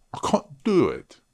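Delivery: high put-on British accent. Tutor showing he can't do the accent, mock British accent. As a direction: say it high, in exaggerated British English. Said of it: "I'm not mocking the British accent".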